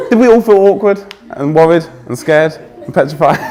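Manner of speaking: teasing the crowd through a laugh. Real, not acted. Speaker teasing the stressed audience, laughing. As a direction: ask it half laughing at them.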